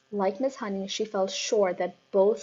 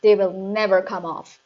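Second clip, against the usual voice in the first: loud dismissive shout; 7 dB louder than usual. A narrator's reading. Headmistress shouting at the teacher, loud. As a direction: brush her off loudly. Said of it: "the Trunchbull shouted".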